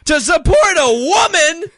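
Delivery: very high, outraged shouting; hammed-up yelling. Shut-out moviegoer protesting to co-host, indignant yelling. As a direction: wail it like a mock tantrum. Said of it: "Why are you yelling?".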